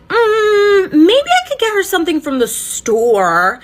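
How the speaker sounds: high, loud put-on voice for Ash; mocking Ash. Narrator mocking Ash to her viewers, high put-on voice. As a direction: squeak it out like a clueless mom. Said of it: "Ash is like".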